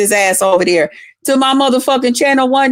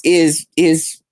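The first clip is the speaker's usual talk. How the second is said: ordinary talk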